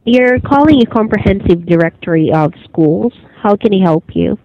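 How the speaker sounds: usual speaking voice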